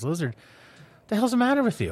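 high-pitched, exasperated joke alligator; exasperated disbelief. Acted. Alligator to stoned lizard on the bank, exasperated. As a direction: scold in disbelief, voice jumping up.

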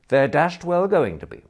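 indignant declaration. A narrator's reading. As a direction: put your foot down loudly. Bertie defies Jeeves.

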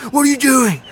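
high, loud alarmed imitation of Stallone; mock-alarmed yelp, pitched well up; Stallone quote. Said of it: "and Stallone".

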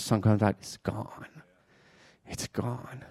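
near-whisper, fading like vapor; hushed. Real, not acted. Preacher on morning vapor vanishing in the sun, hushed. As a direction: let it drop almost to a whisper.

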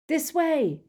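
high, loud urgent call; yelled command. A narrator's reading. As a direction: yell to lead the others on. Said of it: "Harry yelled".

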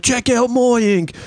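high, slow Australian brag; same Australian guy. Retold or imitated. Sailor shows his arm tattoos, bragging in an Australian accent. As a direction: show off his arms in a pushy Australian voice.

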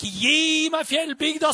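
impassioned shouted plea; retold shout. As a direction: cry it out again with the same fire. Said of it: "Han skreik".

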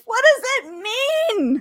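high, loud comic bafflement; exasperated outburst. Pretend. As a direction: cry out in mock despair. Exasperated at vague editor's note.

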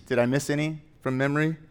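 ordinary delivery

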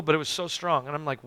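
ordinary talk

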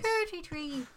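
warm-up phrase in a badly faked Irish accent; attempted Irish accent. Shown as a demonstration. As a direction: test out a shaky grasp of Irish. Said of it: "I'm trying to get the Irish out".

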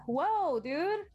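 mock Chris Rock. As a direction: play it off, startled but cool. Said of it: "le dice Chris Rock, le dice". (imitación ligera de Chris Rock, más aguda y fuerte)

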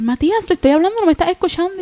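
high-pitched insistent call; retold loud calling. Mother calling her unresponsive son from the bedroom, retold insistent calling. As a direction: call out, pitched well up, as if to another room. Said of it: "porque le hablaba fuerte".

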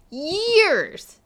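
Years pitched very high and loud; exasperated, soaring Years; indignant stress on Years. Real, not acted. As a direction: cry out Years, drawn out and indignant. Indignant repeat for emphasis.

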